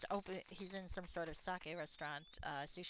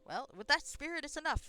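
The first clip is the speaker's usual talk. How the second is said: put-on higher, louder cheery girl's voice; 9 semitones higher, 8 dB louder than usual. Girl in the pub cheering him on, high and bright. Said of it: "some girl there is going".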